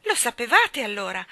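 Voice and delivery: loud, taken-aback question; rather surprised. A narrator's reading. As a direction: ask the children in mild astonishment. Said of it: "chiese missus Banks piuttosto sorpresa".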